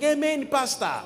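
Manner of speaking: high congregant's cry; imitated calling out. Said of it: "When everybody is quiet, she'd be like".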